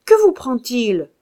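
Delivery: loud, irritated demand; annoyed shout. A narrator's reading. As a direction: call out, put out. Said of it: "cria Claude mécontent". (interpellation fâchée, forte)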